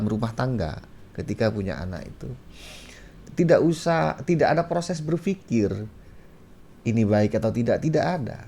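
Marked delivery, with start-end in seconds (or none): none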